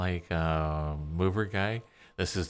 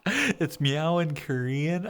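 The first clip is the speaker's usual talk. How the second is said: delighted surprise; 9 semitones higher, 5 dB louder, slower than usual. Real, not acted. Surprised at learning a word.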